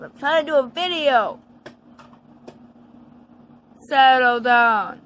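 stern yell for them to settle; scolding shout. Real, not acted. Girl scolding her dogs while filming, loud stern shout.

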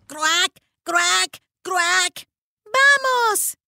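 high, loud crow cry; urgent crow call; cawing shout. Acted. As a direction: shout it out over the caws. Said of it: "gritó Annie".

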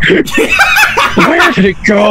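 high, slow laughing question. Real, not acted. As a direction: ask it through laughter. Laughing while searching.

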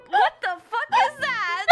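high, loud laughing outburst; laughing shout. Real, not acted. Reacting to friend's raven sound.

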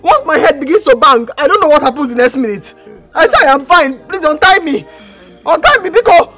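high, loud, urgent insistence; desperate pleading. Acted. Daughter insisting to Papa she is fine, desperate pleading. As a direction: plead loudly to be believed.

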